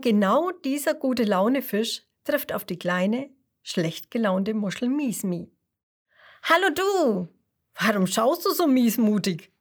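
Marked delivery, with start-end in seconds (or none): 6.4-9.5 cheerful fish. Acted. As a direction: greet her like a sunny friend. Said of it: "fragt der Gute-Laune-Fisch".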